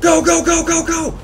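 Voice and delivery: frantic high shouting; panicked yelling while fleeing; being chased. Real, not acted. Chased, yelling run to partner.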